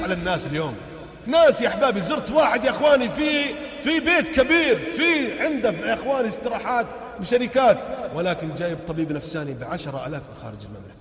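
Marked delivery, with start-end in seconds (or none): 1.3-7.8 high, loud sermon cadence; fiery preaching. Acted. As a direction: preach it out to the crowd. Preaches at full force.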